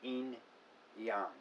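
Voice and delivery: "Yin, yang" as two separate words, EEN, YAHNG; "Yin" like een, pause, "yang" with an open ah. Shown as a demonstration. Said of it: "it is more correctly pronounced".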